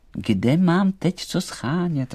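high, slow little doll voice; lightly voiced high doll; protesting doll. A narrator's reading. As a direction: object in a high voice.

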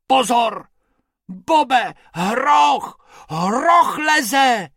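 high, loud alarmed shout. A narrator's reading. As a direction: yell a warning. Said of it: "křičel Bobek".

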